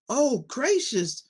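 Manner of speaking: high, slow surprised exclamation; a girl crying out on meeting a witch; startled cry. A narrator's reading. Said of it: "cried Dorothy".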